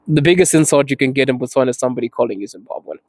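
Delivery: ordinary talk, nothing marked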